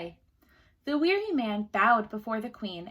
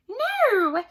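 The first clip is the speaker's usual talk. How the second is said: little man refusing; 11 semitones higher, 8 dB louder than usual. A narrator's reading.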